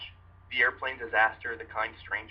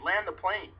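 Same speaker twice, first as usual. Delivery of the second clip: higher, repeated yell; retold scream again; 7 semitones higher, slower than usual. Terrified passenger repeating his plea to land, high shout. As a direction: keep yelling it, just as desperate. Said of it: "while screaming at the top of my lungs".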